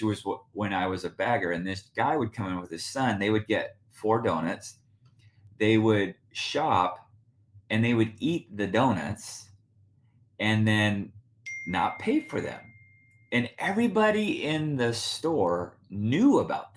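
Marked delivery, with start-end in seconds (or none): none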